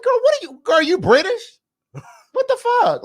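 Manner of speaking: high-pitched incredulous squeal; mock bafflement. Viewer reacting to castmate's odd accent, mock bafflement. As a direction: react in high, exaggerated disbelief.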